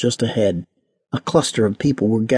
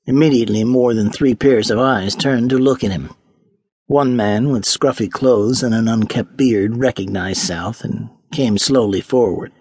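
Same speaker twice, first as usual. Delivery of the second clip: usual speaking voice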